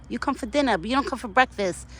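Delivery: ordinary talk, nothing marked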